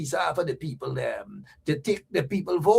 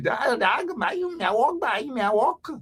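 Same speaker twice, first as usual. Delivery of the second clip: imitating Ganesh; 6 semitones higher than usual. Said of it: "That's Ganesh".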